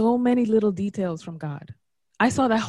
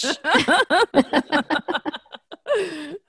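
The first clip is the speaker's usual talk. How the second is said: high laugh; 8 semitones higher than usual. Real, not acted. Amused by the host's bad accent, high laughing. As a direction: burst into a high rising laugh.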